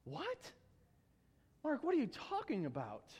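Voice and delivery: high, quiet, rising what, then puzzled; incredulous, comic double take; mock bafflement. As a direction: play the confused reader questioning the writer. Acts baffled reader questioning Mark.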